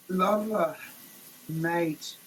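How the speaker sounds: ordinary delivery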